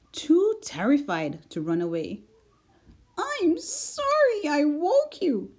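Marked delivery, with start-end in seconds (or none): 3.2-5.5 high, slow, frightened little mouse voice; trembling. A narrator's reading. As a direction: squeak it out, scared stiff.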